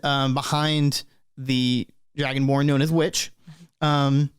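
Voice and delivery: ordinary talk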